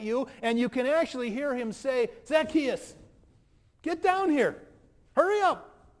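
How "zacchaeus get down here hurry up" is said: high, loud call up into the tree; Jesus calling out. Acted. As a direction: call him down, eager and loud. Jesus calls Zacchaeus from tree.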